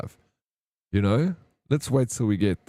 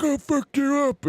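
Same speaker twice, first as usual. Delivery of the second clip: much higher, slow squeaky mock threat; drunk old man. Drunk old man squaring up to young guys, squeaky slow mock threat. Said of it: "He's like".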